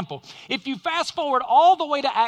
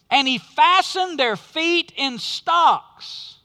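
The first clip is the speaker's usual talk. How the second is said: slow, loud, high buildup ending in a call; dramatic preaching build; 5 semitones higher, 8 dB louder, much slower than usual. Acted. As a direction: draw the crowd to the answer, slower and louder.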